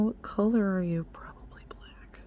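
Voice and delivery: whispered aside, fading very soft; musing whisper. Real, not acted. Vlogger checking the eyeliner's color, musing whisper. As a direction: whisper to the thing in your hand. Murmurs to the eyeliner being examined.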